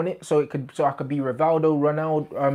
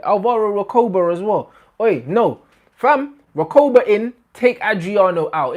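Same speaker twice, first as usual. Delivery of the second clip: hyped outburst; 7 semitones higher, 7 dB louder than usual. Real, not acted. Sudden change of mind, hyped.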